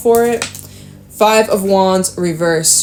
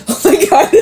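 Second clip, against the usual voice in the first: giggling at herself, high; laughing at her own whisper. Real, not acted. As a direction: break into real laughter.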